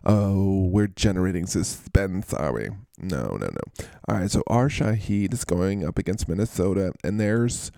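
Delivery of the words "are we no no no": very low, fading voice; deep low answer. Acted. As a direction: drop way down and fade out. Dropping voice answering himself.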